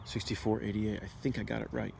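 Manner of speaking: ordinary talk, nothing marked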